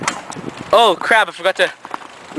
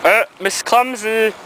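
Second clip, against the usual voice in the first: slow, high mocking taunt; teasing; 6 semitones higher, slower than usual. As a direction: drag it out to tease. Teasing friend who fell behind.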